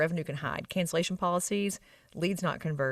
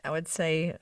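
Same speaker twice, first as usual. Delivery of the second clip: ordinary delivery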